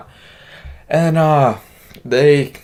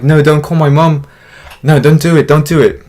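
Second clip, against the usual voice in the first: pleading; 4 semitones higher, 7 dB louder than usual. Retold or imitated. Begging babysitter not to call.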